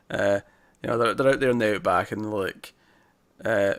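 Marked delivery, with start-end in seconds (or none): none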